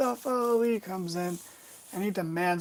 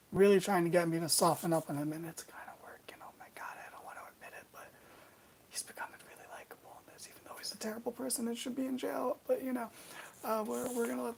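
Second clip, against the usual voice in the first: hushed guilty whisper, barely voiced; 5 semitones higher, 6 dB quieter, faster than usual. Real, not acted. Vlogger confessing to viewers that he likes Sami, whispering. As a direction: whisper it like a secret you don't want heard.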